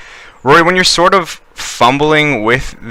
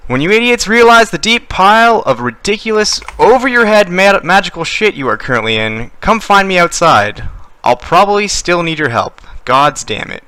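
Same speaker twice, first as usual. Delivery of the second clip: higher, fading yell from across the room; orc woman yelling as she retreats; 8 semitones higher than usual. Acted. Orc woman shouting to the party as she flees, fading away. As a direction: call back over your shoulder while running off. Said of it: "her voice moving sort of further and further away as she yells".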